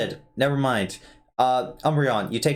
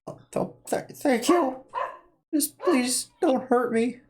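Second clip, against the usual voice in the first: put-on old man voice; 11 semitones higher than usual. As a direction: stammer thanks like a frightened old man. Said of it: "this is my default old man voice".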